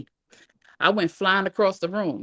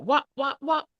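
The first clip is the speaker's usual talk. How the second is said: three higher-pitched whops; belt smack imitation; 8 semitones higher than usual. A narrator's reading. Narrator imitating three belt strikes, rhythmic smacks. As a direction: voice repeated blows of a belt.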